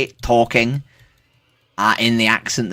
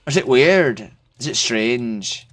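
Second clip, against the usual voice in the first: lilting put-on Irish accent; 5 semitones higher, 3 dB louder, slower than usual. Streamer asks a friend if it's weird, Irish accent. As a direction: chat teasingly in an Irish accent. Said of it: "I've never talked to you in Irish accent".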